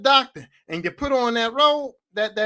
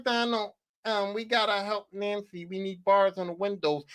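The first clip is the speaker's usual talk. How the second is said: softer and lower, mock pleading; a hint of a tipsy wife asking; drunk mom; 4 semitones lower, 7 dB quieter than usual. Drunk mom asks her husband for bars, in a softer, lower mock plea. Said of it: "Your drunk ass was like".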